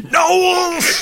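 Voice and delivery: high, loud yelled name; furious shout. Retold or imitated. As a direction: bellow the name in rage. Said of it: "he shouts".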